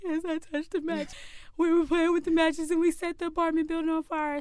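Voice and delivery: high wailing kid confession, imitated; mock crying confession. Crying child confessing to dad, a high mock wail. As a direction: blurt it out high and loud like a bawling child. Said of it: "by this time I'm crying".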